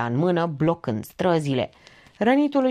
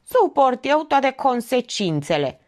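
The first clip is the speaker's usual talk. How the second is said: higher, slower defiant call; acted shout; 7 semitones higher, 3 dB louder, slower than usual. A narrator's reading. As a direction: declare it loudly, taking your time. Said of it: "strigă un bărbos". (strigăt sfidător, mai înalt)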